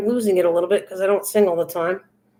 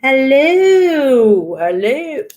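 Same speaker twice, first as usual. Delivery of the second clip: hooted, swooping comic greeting; Missus Doubtfire; 3 semitones higher, 4 dB louder, much slower than usual. Acted. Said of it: "Missus Doubtfire".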